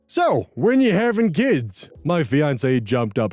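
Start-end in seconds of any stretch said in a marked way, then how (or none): 0.1-1.9 high, drawn-out prying question; slow, high-pitched meddling relative; nosy uncle voice. Acted. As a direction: put on a nosy uncle, stretching it out.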